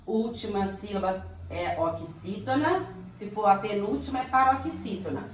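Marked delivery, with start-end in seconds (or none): none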